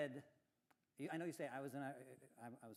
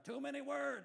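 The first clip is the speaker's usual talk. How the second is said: much higher mock whine; mock complaint; 11 semitones higher, 4 dB louder than usual. Imitating Ray and John's complaint, pitched high. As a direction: squeak out a teasing protest. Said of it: "Ray and John are going".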